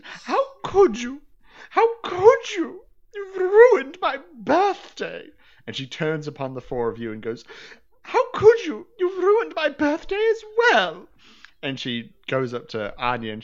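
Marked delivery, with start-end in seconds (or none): 0.0-5.3 much higher, louder, slow sobbing wail; mock crying wail. Noblewoman laments her ruined birthday, sobbing wail. 8.0-11.1 mock crying wail. Noblewoman laments her ruined birthday, sobbing wail.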